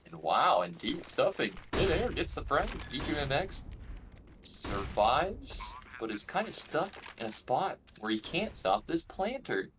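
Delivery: esports caster. Acted. Live esports play-by-play.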